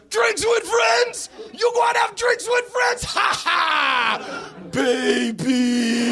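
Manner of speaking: put-on very high, incredulous Jamaican-accented shout; outraged Jamaican cabbie. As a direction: yell in Jamaican disbelief, ending in a mock laugh. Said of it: "my Jamaican accent".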